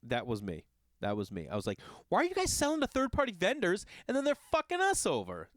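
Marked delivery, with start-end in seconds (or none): 2.1-5.5 high, heated complaint at the clerk; pitched-up outrage at the box office; mock indignant rant. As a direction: let the outrage climb.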